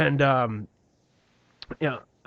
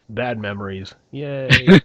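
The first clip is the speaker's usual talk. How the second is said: sarcastic cheer; 9 dB louder, much slower than usual. Retold or imitated. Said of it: "I was like".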